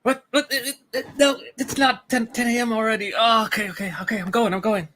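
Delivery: high, startled, rushed waking; flustered panic. Acted. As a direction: jolt awake late and panicky. Oversleeping character panics awake.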